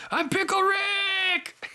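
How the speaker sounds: high, slow, stretched cartoon shout; Pickle Rick shout. Quoting Rick's catchphrase as a high, slow cartoon yell.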